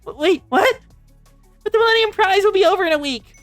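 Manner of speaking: high, loud cry of disbelief; shocked protest. Acted. Girl to student council on hearing of the suspension, shocked protest.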